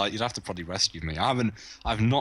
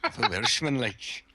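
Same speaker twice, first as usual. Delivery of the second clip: half-hearted Welsh lilt; lightly put-on Welsh accent; attempted Welsh accent; 3 semitones higher than usual. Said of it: "I can't do a Welsh accent".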